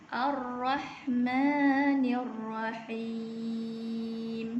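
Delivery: slow, measured tajwid recitation; Quran recitation. Shown as a demonstration. Teacher recites the second verse for students. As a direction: chant it slowly with long held vowels. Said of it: "bacaan untuk ayat yang ketiga ni ialah".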